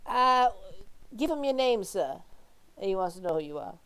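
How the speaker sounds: lightly voiced, hesitant tavern boy; young boy. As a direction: relay the question softly as a boy.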